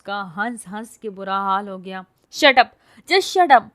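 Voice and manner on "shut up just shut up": high loud furious shout. A narrator's reading. Girl screams at the laughing man, shouting. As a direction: scream at him to stop. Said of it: "زور سے چلائی".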